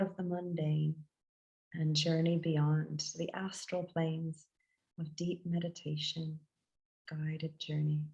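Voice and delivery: guided meditation. Acted. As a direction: guide listeners gently inward, phrase by unhurried phrase.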